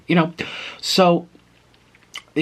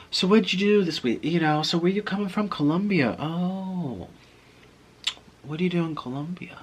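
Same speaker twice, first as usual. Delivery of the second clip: imitated flirty small talk, higher, softening; mock-coy questions in a raised voice; flirtatious; 6 semitones higher than usual. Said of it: "in a way that was flirtatious".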